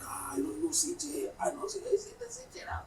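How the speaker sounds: whispered, high, feigning ignorance; mock whisper. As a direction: hush it like you pretend not to know him. Husband pretends not to know.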